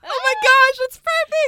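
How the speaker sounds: high, loud excited squeal; thrilled. Real, not acted. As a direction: burst out in real delight, very high and loud. Thrilled reaction to the casting.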